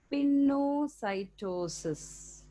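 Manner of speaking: very slow, sustained teaching delivery; slow dictation. Real, not acted. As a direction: stretch the new term out for note-takers. Introducing a new term for notes.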